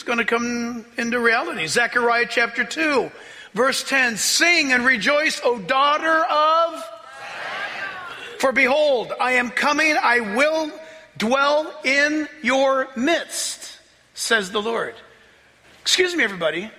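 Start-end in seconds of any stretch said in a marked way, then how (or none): 4.2-14.9 preaching read. Acted. Reads prophecy in preaching voice.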